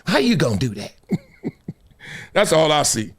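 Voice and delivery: ordinary talk, nothing marked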